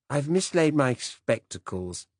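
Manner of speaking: a fussy, absent-minded British gent apologizing; hesitant upper-class old man, drawn out; vague old Director General. Acted.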